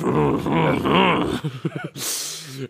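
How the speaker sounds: villain's bad guy laugh; evil chuckle. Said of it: "a bad guy laugh".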